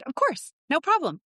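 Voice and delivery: high, bright yes of course; eager agreement. Retold or imitated. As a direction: play the eager client agreeing to everything. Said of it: "she said".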